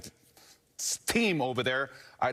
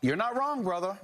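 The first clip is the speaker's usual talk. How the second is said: pitched up high and emphatic, stressing wrong; you're not wrong said high and punchy; emphatic agreement; 8 semitones higher than usual. Real, not acted. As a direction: jump in with eager agreement.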